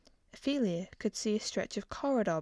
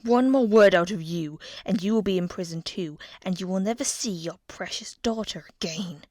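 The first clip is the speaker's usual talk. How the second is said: cold threat from the king, pushed louder; menacing threat; 7 dB louder than usual. A narrator's reading. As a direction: threaten her with her daughter. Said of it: "he hissed".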